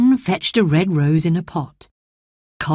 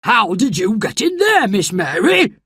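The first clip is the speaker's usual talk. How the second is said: cross shout; 4 semitones higher than usual. Acted. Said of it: "he shouted crossly".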